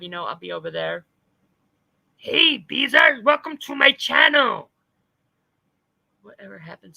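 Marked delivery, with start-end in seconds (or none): 2.2-4.7 slow, raised, loud announcement; hammed-up booming hello; shouted greeting. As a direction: bellow a big welcome.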